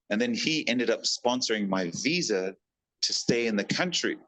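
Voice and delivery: normal talking voice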